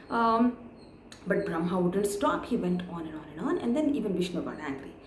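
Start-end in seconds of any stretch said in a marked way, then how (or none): none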